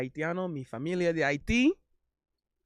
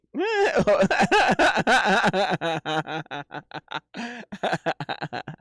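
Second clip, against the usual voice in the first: loud laugh; 5 semitones higher, 8 dB louder than usual. Real, not acted. Laughing after cheer.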